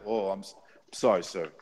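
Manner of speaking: mock-meek, soft and low; meek apology. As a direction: shrink into a small, cowed apology. Mocks a tough guy suddenly backing down.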